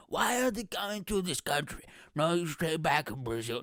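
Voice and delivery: hammed-up nationalist protester; Nigel Farage. Mock banner-waver objecting to newcomers, indignant put-on voice. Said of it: "going full Nigel Farage".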